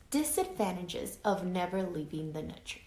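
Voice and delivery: ordinary delivery, nothing marked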